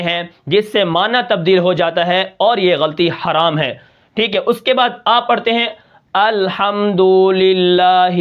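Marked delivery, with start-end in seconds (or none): 6.1-8.1 الحمدللہ drawn out very slowly in recitation tune; wrongly stretched recitation. Shown as a demonstration. Teacher showing students an over-stretched الحمدللہ. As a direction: chant الحمدللہ with overlong vowels. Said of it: "تو یہ کیا آپ بڑھا رہے ہیں".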